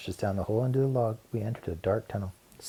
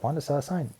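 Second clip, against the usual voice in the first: plain reading voice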